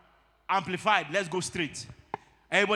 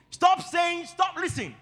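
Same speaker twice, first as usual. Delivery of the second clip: sharp rebuke; 8 semitones higher, slower than usual. Real, not acted. Preacher rebukes wrong confession.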